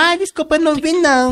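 mock apology. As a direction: play dumb and apologize falsely. Woman's fake apology voiced in story.

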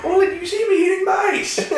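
high giggling mock outrage; laughing mock cop. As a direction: keep the bit going while cracking up. Continues cop imitation.

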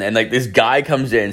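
ordinary talk, nothing marked

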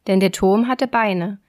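usual speaking voice

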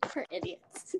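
dry whispered aside; whispering. Real, not acted. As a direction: mutter it under your breath. Sarcastic aside after story.

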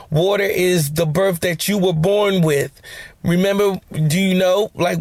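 ordinary talk

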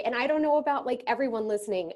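ordinary delivery

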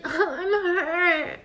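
high, drawn-out fake crying; mock whimper. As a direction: whine pitifully over a tiny scratch. Mocking a tiny paper cut.

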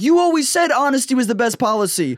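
high-pitched mock outrage; hammed-up squeaky accusation; indignant protest. Acted quarrel with his dad.

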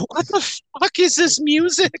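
high, disbelieving squeak; incredulous outburst. Retold or imitated. Host reacting to French music, high incredulous squeak. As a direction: pitch it way up in mock disbelief. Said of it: "I'm like".

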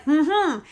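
imitated toothbrush mumble. Answering the roommate while brushing teeth, muffled humming. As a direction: answer as if your mouth is full of toothpaste. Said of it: "So I'm brushing my teeth".

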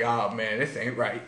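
quiet dismay. Retold or imitated. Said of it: "I'm just like".

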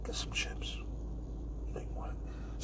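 hushed, sly whisper, like a devil on the shoulder; tempting whisper. Pretend. Whispering to himself to tempt a craving for chips. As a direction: whisper it to lure him to the junk food.

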